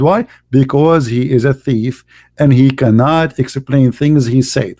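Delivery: ordinary talk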